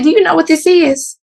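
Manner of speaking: high, challenging question; retold indignant challenge. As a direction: challenge him, pitched high. Confronting staff about pump.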